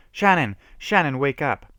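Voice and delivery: high, loud call to a friend; desperate shout. A narrator's reading. Kenny calls to the unconscious Shannon, loud and desperate. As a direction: shout to wake her. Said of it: "shouted Kenny".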